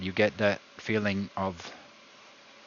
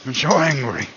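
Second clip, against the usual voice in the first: mock anger; 5 semitones higher, 11 dB louder than usual. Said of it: "it makes me so angry".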